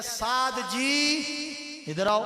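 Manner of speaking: slow, stretched polite calling; respectful call. Shown as a demonstration. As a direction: draw out "سعد جی" gently and courteously. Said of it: "یہ بھی ایک طریقہ ہے".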